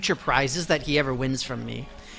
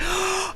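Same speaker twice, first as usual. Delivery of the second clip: sharp high gasp; a mock gasp of shock; 13 semitones higher, 6 dB quieter than usual. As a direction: gasp as if stunned. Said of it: "genuinely shocked".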